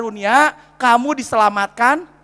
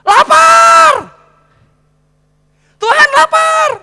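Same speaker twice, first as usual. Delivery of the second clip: high, loud, stretched hungry yell; hammed-up full-throated cry; acted shout; 11 dB louder, much slower than usual. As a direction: holler to God as a mock starving man. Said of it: "Teriak lagi".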